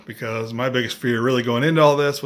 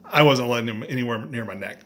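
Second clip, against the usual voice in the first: usual speaking voice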